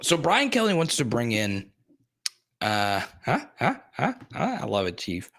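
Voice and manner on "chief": ordinary delivery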